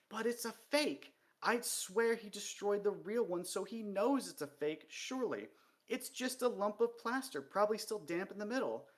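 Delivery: high, hurried worried voice. Acted. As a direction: press the point anxiously. Cheery's urgent whisper to Vimes.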